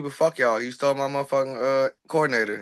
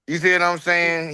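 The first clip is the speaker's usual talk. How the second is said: normal talking voice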